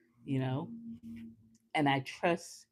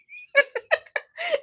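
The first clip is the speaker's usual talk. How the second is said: a squealing laugh, much higher; high delighted laugh; 7 dB louder than usual. Real, not acted. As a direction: burst into a giddy laugh. Delighted laugh after reply.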